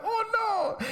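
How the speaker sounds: high squeaky "Oh no."; mock panicked squeal. Imitating Connor in the lab, a high mock-panicked squeal. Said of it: "He'll be like".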